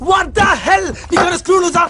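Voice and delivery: high-pitched, indignant outburst; outraged shout. Acted. Man rounds on his date in a quarrel, outraged shout. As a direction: shout it in offended disbelief.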